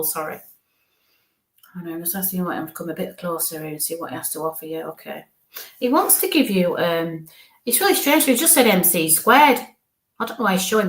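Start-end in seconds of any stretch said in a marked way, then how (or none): none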